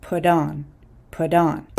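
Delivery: "put on" with the T as a quick D, like "pud on". Shown as a demonstration. Teacher shows learners the quick D in "put on". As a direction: tap the T in "put on" into a fast D. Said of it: "with a quick D".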